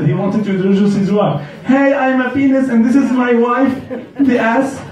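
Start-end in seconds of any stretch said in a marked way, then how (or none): 1.7-4.8 cheerful introduction. Retold or imitated. Friend introducing his wife.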